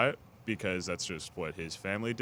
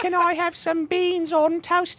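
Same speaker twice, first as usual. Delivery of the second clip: put-on much higher, loud British accent, "Mummy"; posh British child; 8 dB louder than usual. Asked about saying mum, friend hams up a high British child. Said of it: "Throw 'em with that British accent".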